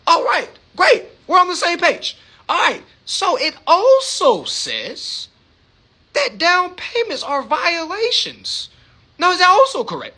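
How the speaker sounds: lebih tinggi, lambat dan tenang; penelepon resmi. Retold or imitated. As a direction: tegas tapi santun seperti memeriksa. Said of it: "And I say".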